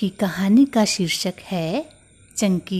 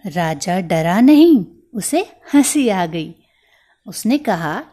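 usual speaking voice

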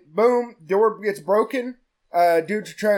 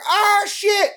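a much higher, louder shocked cry; startled yelp; 13 semitones higher, 3 dB louder than usual. Retold or imitated. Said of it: "they're like".

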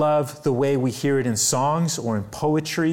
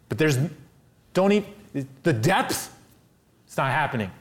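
imagined hurt rejection; 3 semitones higher than usual. Pretend. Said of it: "say what we would expect".

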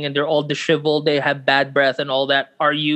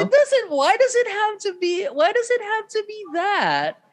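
much higher, giggly protest; laughing protest. Real, not acted. Friend objects to brain boner, laughing high. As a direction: squeal through laughter.

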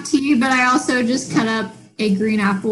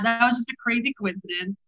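usual speaking voice

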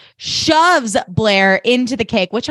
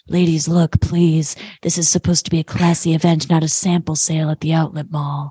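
lower, smooth put-down; suave Chuck; 4 semitones lower than usual. Retold or imitated. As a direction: talk down to them, cool and lofty. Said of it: "he's like".

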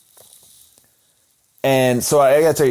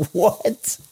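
stunned disbelief; 8 semitones higher, slower than usual. Retold or imitated. Shocked at the janitor news.

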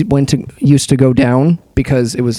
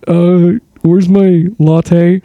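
slow, dim complaining voice; mimicking a slow customer asking for his latte; slow dopey customer; 3 semitones higher, 4 dB louder, much slower than usual. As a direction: drag it out like a lost customer. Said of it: "He was just like".

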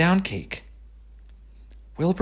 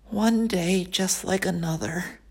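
soft, high groaning pig; 11 semitones higher, 5 dB quieter than usual. Acted. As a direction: groan it out, lonely. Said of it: "he groaned".

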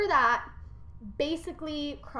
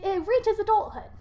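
high pleading voice; mother instructing; 7 semitones higher than usual. Retold or imitated. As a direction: ask the nymphs to watch him. Rhea instructing nymphs.

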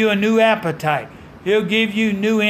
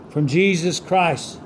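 usual speaking voice

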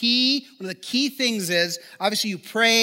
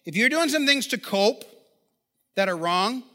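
ordinary delivery

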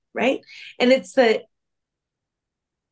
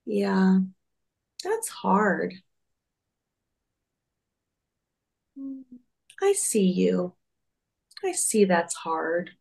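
gentle Sadness; slower than usual. Retold or imitated. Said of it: "sits down next to him and goes".

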